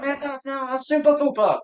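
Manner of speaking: high mock gang voice; mock gangster. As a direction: play the offended clique member. Acting gang members' quarrel.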